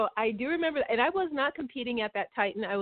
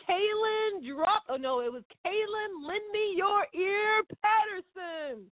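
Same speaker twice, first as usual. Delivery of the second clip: mock announcer; 11 semitones higher, slower than usual. Teasing mock announcer name.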